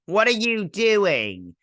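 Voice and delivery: mock demanding. Recapping show, character demands.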